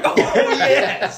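high, loud mock cheer through laughter; imitated fan delight. Imitates fans getting the voice.

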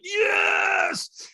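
very high, long screamed yes; full-lung scream. Retold or imitated. Firewalker mad at the trainer, screaming with full lungs. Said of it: "I screamed at the top of my lungs".

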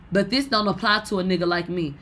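ordinary talk

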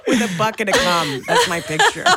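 laughing. Real, not acted. Laughing at crude joke.

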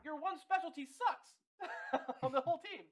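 high, slow, incredulous laugh-talk; laughing mockery. Real, not acted. Host ridiculing the coach's one specialty, high-pitched and laughing. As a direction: laugh through a drawn-out jab.

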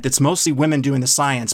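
ordinary delivery

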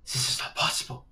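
breathless, hushed shock; whispered disbelief. Acted. Innkeeper seeing figures float into the inn, whispered shock. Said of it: "Fraulein Magda is going to turn and say".

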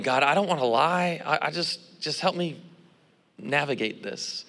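soft inner plea to God; silent prayer. Retold or imitated. Student praying in his head before the professor, soft plea. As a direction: trail softly into a pleading prayer. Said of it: "You know, I'm thinking".

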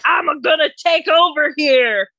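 high, loud, drawn-out mock holler; hammed-up shouted declaration; imitated balcony yell. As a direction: yell it like a man ranting from his balcony. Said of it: "He's yelling from his balcony".

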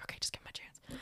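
whispered pep talk to herself; whispered self-talk. Retold or imitated. Telling herself to give him a chance, whispered. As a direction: coax yourself under your breath.

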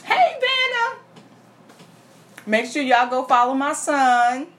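lightly voiced proud mama; a hint of a loud mama; Mama character. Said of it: "go in the character".

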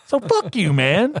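high mocking insult. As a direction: taunt him, pitch climbing. Insulting a listener in jest.

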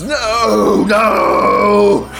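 high, loud anguished no; yelling. Acted. Rider watches his dragon fly off, yelling. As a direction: cry out as your dragon leaves. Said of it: "the dragon rider just yells".

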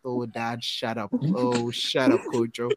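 low, hushed mock embarrassment; embarrassed kid. Embarrassed kid pleading with cheering dad at the game, low mock embarrassment. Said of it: "their kid is so embarrassed".

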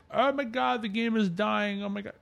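high, mock-panicked wail; mimicking panicked players, mock alarm; mocking doom-saying fan. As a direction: parody the fans crying doom. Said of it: "everybody's saying".

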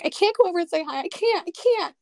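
high, flustered panic; panicked. Retold or imitated. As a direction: squeal it out in a nervous rush. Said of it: "And I was like".

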